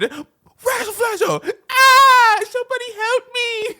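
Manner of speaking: high shrieking cartoon panic; panicked screaming. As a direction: scream for help like a cartoon kid. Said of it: "quoting Billy".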